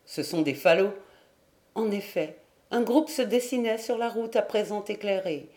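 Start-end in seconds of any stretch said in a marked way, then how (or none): 0.0-0.9 low, mildly derisive voice; self-mocking sneer. A narrator's reading. Companion shaking off absurd terror, a low self-mocking sneer. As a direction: scoff lightly at your own fear. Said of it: "ricana, se moquant de lui-même".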